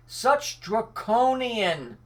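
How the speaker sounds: "Such draconian" drawn out slowly, "Such" leaned on hard; slowly stressed. Shown as a demonstration. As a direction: savour each syllable of "Such draconian". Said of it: "here's another good word".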